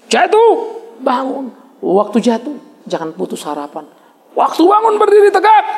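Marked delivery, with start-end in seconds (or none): 0.1-1.5 emphatic call. Acted. Sermon build. 4.3-5.6 high, loud exhortation; rousing sermon cry; rousing call. Acted. Exhort standing tall.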